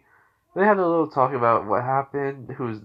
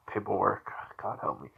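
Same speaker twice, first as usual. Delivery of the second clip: low, hushed exasperated mutter; muttered complaint; 4 semitones lower, 8 dB quieter than usual. Acted. Hokage grumbling to himself over paperwork, muttered. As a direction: grumble it under your breath, trailing into a whisper.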